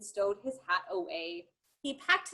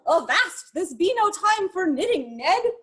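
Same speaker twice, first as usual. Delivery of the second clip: captain calling out; 6 semitones higher, 5 dB louder than usual. Acted. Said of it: "The captain called".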